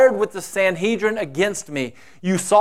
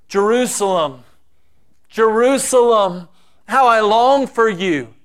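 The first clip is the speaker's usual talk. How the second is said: louder, aching cry of longing; mournful lament; 4 semitones higher, 4 dB louder than usual. Acted. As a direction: cry out over the city with longing. Jesus lamenting over Jerusalem.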